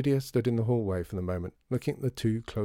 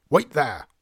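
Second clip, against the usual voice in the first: louder, higher, firm order; shouting; 4 semitones higher, 7 dB louder than usual. A narrator's reading. Shopkeeper halting children in storeroom, shouting. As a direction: call it sharply to someone out of sight. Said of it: "shouted Mister M".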